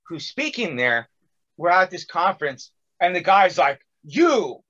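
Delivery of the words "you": raised, pointed call across a room; higher, emphatic call-out; speaker calling someone out. Retold or imitated. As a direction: imitate a preacher singling someone out. Retelling conference speaker singling him out.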